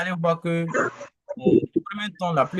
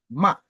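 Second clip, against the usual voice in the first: "ma" falling sharply; short, emphatic drop on "ma"; fourth tone; 4 dB louder than usual. Shown as a demonstration. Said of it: "le quatrième ton".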